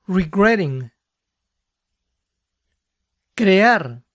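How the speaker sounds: ordinary talk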